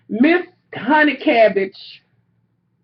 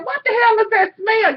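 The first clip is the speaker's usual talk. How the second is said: high, pained cry of disgust; retold shocked yelp; disgusted outcry; 11 semitones higher than usual. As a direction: recoil at a foul smell. Disgusted at hotel room smell.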